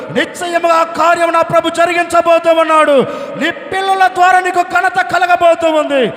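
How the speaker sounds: loud, sustained prophetic declaration; impassioned sermon climax; full-force preaching. Real, not acted.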